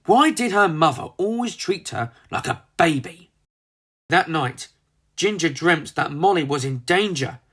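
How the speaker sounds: ordinary delivery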